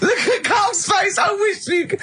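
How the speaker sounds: real gleeful shout; hysterical glee. Real, not acted. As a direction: crack up at his face. Laughing at Carl's reaction.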